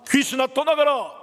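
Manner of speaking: high, loud command; declaration prayer. Shown as a demonstration. Paul casting out the spirit, loud declaration. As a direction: proclaim it forcefully, high and loud. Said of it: "이게 바로 선포 기도하는 거예요".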